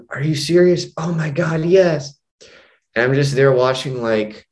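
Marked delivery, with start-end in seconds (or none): 0.1-2.2 high-pitched, imitated thrilled girlfriend; excited acceptance. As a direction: gush it in a raised voice, overjoyed. Acted woman offered a proposal fix.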